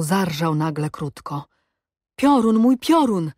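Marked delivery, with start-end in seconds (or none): 2.2-3.3 loud, high glad shout; joyful cry. A narrator's reading. Awicz greets his horse with a loud joyful cry. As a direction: call out to the beloved horse. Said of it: "krzyknął Awicz".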